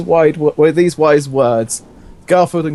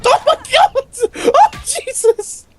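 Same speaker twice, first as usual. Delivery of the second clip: high, crying shriek of horror; shrieking shock; slower than usual. Real, not acted. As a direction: squeal out in laughing disgust. Shocked by a sudden image.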